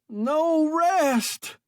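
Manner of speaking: loud, very high scream. A narrator's reading. Stranded man screaming at rescuers, panicked scream. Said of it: "screamed Sale".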